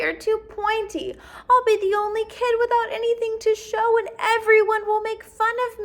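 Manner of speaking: put-on high, upset child's voice; worried little girl. Jessica telling her parents her fear, high and upset. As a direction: fret like a kid close to tears.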